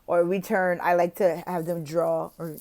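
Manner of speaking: ordinary talk, nothing marked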